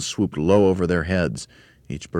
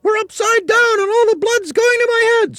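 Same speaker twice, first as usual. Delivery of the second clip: very high, loud panicked screech; screamed; 9 dB louder than usual. A narrator's reading. Mrs Twit feels blood rush to her head, screaming. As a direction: scream it in terror. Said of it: "screamed Missus Twit".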